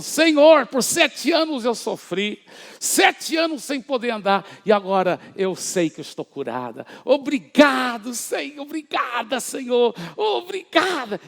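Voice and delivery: high, loud joyful cry of thanks; a retold woman's ecstatic gratitude to God. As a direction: pour out thanks in high joy. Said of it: "Ela falou assim com tanta alegria".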